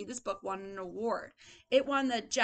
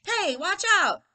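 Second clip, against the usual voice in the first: high, loud warning; alarmed cry; 9 semitones higher, 11 dB louder than usual. Acted. Teacher voices bumped character, loud startled shout. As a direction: shout at someone bumping into you.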